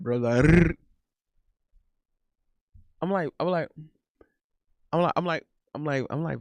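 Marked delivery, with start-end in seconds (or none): 0.4-0.7 rolled r. Retold or imitated. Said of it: "she always would roll it".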